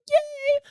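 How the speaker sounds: high sarcastic squeal of yay; mock cheer. Real, not acted. Admitting she would have lied, a mock cheer. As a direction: cheer ironically at her own lie.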